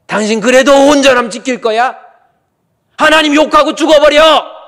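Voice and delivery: high, loud, screaming rebuke; Job's wife's furious shout. Retold or imitated. Preacher voicing Job's wife cursing her husband, shrieking in fury. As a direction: hurl it at your suffering husband at full voice. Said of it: "그때 욥의 아내가 뭐라고 한지 아세요?".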